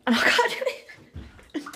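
slow, high laughing. Real, not acted. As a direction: laugh through the words. Laughing at failing to get in.

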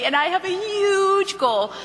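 "huge" stretched long and high; emphatic huge. Shown as a demonstration. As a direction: draw out "huge" with a swooping rise. Demo sales script, stretched boast.

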